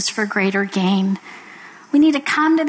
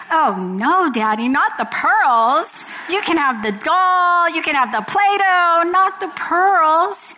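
put-on higher-pitched child bargaining with daddy; pleading little girl; 5 semitones higher than usual. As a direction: draw out a little girl's plea, pitched up. Little girl begging to keep pearls.

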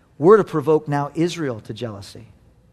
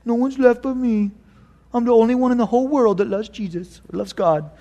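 sulky self-pitying whine; pouting Elijah; 6 semitones higher than usual. Retold or imitated. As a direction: sulk like a child in a cave. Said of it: "he pouts".

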